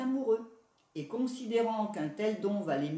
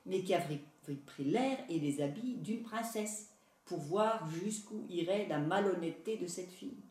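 usual speaking voice

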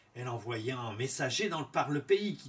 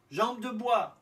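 higher, hopeful guess; queen's voice; 9 semitones higher, 5 dB louder, slower than usual. A narrator's reading. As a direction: ask the name hopefully, higher. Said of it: "elle fit demander".

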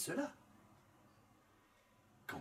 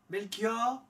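higher, hopeful guess; queen's voice; 9 semitones higher than usual. A narrator's reading. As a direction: ask the name hopefully, higher. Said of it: "son énumération".

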